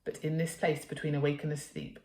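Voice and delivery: plain reading voice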